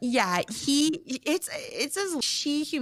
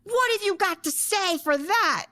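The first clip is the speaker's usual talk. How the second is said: high-pitched mock challenge; irate; 10 semitones higher than usual. Wife goading her ex in public, irate. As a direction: demand an answer, voice way up. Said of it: "just irate".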